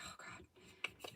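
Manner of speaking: breathy, voiceless sigh of oh God; a tired parent whispering to herself; whispered exasperation. Retold or imitated. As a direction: whisper it, fed up with the drama. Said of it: "I'm like".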